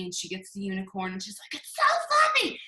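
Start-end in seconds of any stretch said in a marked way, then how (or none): 1.6-2.6 put-on squealed high and loud, little girl voice; shrieking little girl delight; Agnes from Despicable Me. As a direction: squeal it like a child hugging a unicorn. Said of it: "like, the little girl on Despicable Me".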